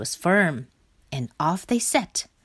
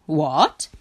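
startled storybook question; short, surprised exclamation; exclaiming; 3 semitones lower, 4 dB louder than usual. A narrator's reading. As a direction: exclaim in mild disbelief. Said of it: "exclaimed Rat".

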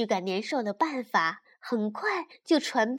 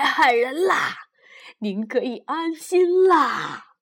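put-on loud, hearty old man laughing; smiling voice; 8 dB louder than usual. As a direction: boast cheerfully with a laugh. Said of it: "老人这时笑着说".

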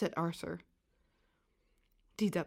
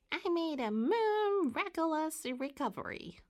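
high, squeaky cartoon voice; Mary Moo Cow; 8 semitones higher than usual. A narrator's reading. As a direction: squeak it out like a kiddie-show cow. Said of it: "answered in a squeaky voice".

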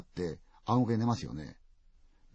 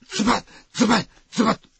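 rising louder with each stab; stab, stab, stab getting louder; stabbing sound effects; 11 semitones higher, 10 dB louder than usual. A narrator's reading. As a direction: hit each slash harder.

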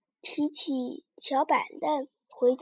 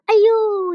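sudden loud high ouch; loud pained yelp; startled cry of pain; 7 semitones higher, 11 dB louder than usual. A narrator's reading. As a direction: yelp as the hook pierces. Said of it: "一声".

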